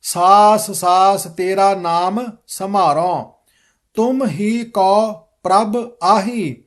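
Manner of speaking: slow, measured recitation of scripture; careful model reading. Shown as a demonstration. Teacher modelling the shabad for students, slow careful recitation. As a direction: read it out slowly, every word distinct. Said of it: "ਸ਼ਬਦ ਸ਼ੁੱਧ ਕਰਵਾਵਾਂਗਾ".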